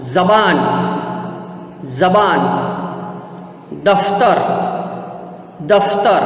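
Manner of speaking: ज़बान with z, दफ्तर with f; nukta pronunciation. Shown as a demonstration. Teacher models for students, ज़बान with z, दफ्तर with f. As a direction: model ज़बान and दफ्तर as isolated words, z and f clear. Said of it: "मैं कैसे करता हूँ नुक्ता वाले शब्दों का उच्चारण".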